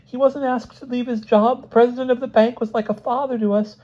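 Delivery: very high, insistent wife's voice; tearful outpouring. A narrator's reading. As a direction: defend your husband in a high-pitched rush of words. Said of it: "words flowed from Missus Smith like".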